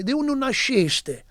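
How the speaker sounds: asking where you were born, Sicilian style; Sicilian dialect. Shown as a demonstration. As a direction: say "De uno nasciste" slowly in Sicilian. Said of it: "Sicilians would say".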